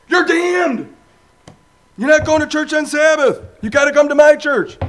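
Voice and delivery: high, loud hammed-up hellfire preacher; mock street preacher. Imitating a street preacher who tells people they are damned, high and loud. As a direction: shout it like a pushy street preacher condemning you.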